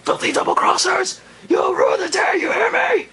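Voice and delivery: high, shouted angry Vegeta impression; Vegeta's wrath. Vlogger imitating Vegeta raging at Gohan, shouting. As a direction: rage at the double crossers in a strained high yell. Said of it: "he's like".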